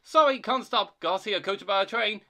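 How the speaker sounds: high, loud, fast excuse; hurried. A narrator's reading. Thomas flees from Percy, hurried. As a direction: rush it out as you bolt. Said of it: "he went on hurriedly".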